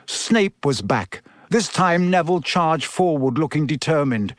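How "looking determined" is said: ordinary delivery, nothing marked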